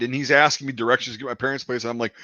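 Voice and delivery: usual speaking voice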